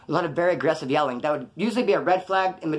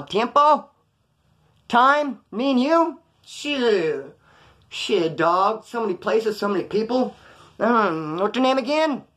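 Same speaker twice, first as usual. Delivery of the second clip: stalling bluff; 5 semitones higher, 3 dB louder, much slower than usual. Retold or imitated. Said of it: "I'm all".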